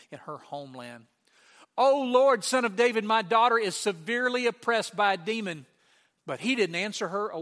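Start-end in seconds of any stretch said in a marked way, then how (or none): 1.8-5.6 loud, high plea of a desperate mother; crying out. Retold or imitated. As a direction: cry out for help, acting her part. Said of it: "she's crying".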